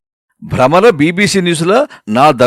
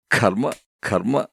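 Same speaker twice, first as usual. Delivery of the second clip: low, slow, hushed muttering; resigned lament; 4 semitones lower, 10 dB quieter, slower than usual. Acted. Husband bemoaning his fate alone, resigned lament. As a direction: sigh it out softly, defeated.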